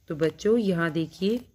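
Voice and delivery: ordinary talk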